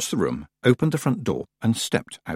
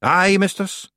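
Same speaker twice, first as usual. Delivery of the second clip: high, proud Scots reply; 11 semitones higher, 4 dB louder, slower than usual. A narrator's reading. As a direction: answer proudly as a high-voiced Scots clansman. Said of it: "said Rob proudly".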